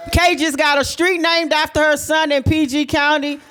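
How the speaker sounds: proud high announcement; proud hype. Real, not acted. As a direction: hype her up to the crowd. Hyping sister's achievements.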